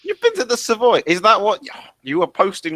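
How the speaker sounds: high, loud disbelief; incredulous outburst. Real, not acted. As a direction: demand an explanation, scandalised. Mock outrage at friend's Savoy visit.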